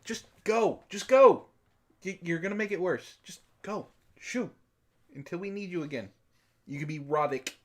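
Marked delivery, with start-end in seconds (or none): none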